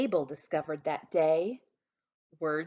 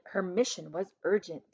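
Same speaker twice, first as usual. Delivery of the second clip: usual speaking voice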